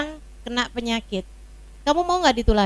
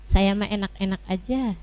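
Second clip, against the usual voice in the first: lower-pitched, mildly detached self-talk; unbothered onlooker; 5 semitones lower, 5 dB quieter than usual. Acted. Wife watching her angry husband, lightly unbothered. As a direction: shrug it off as if talking to yourself. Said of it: "liatin aja".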